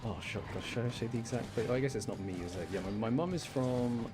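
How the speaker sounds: usual speaking voice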